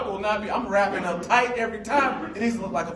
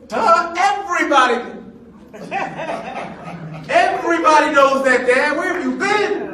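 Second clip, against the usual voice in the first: teasing daughter; 6 semitones higher, 6 dB louder than usual. Retold or imitated. Daughter teasing dad, voiced.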